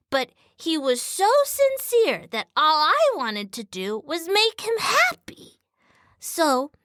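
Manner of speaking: lightly voiced little dog. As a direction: read it as a tiny dog speaking. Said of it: "gonna do more dog, dog voice".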